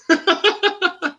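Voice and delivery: real burst of laughing at the joke; high burst of laughter. Real, not acted.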